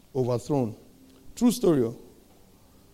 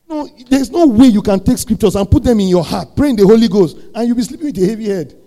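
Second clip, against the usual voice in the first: high, loud preacher's exhortation; impassioned preaching; 7 semitones higher, 10 dB louder than usual. Real, not acted. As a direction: drive it home at full force, pitched high. Impassioned preaching at full force.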